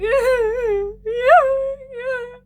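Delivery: high, wailing mock sob; hammed-up blubbering yeah; mock crying. Reunited with his friend, he wails in mock sobs. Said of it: "a whole crying session and is like".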